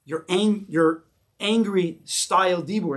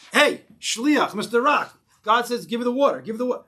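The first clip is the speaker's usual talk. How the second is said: louder, higher pushy order; raised-voice scolding demand; mock shout; 7 semitones higher, 4 dB louder than usual. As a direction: act out hollering a command at someone. Acting out shouting at the rock.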